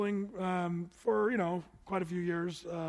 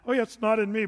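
false humility; 3 semitones higher, 7 dB louder, faster than usual. Retold or imitated. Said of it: "it can almost be us saying".

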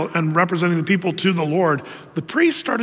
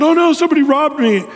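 higher, louder mock panic; hammed-up cry of a robbed mother; panicked mother; 8 semitones higher, 5 dB louder than usual. Mother in the story finds her silver gone, mock panicked.

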